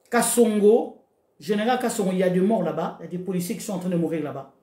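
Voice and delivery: ordinary talk, nothing marked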